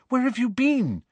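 high-pitched worried question; a mild anxious rush as he comes in. A narrator's reading. Hermione to Ron at the portrait hole, anxious and high. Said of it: "said Hermione anxiously".

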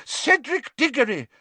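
high, loud indignation; furious. A narrator's reading. McGonagall furiously naming the dead, high and loud. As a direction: fling the names at him.

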